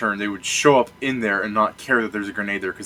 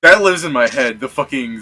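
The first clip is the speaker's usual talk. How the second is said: ordinary talk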